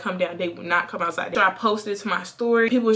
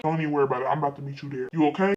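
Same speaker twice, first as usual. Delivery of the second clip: lower-pitched, steady dad voice; cool dad; 7 semitones lower, 4 dB quieter, much faster than usual. Retold or imitated. Dad promising to meet his daughter there, cool and low. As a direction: imitate a calm, collected father. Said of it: "My dad is a little bit more cool".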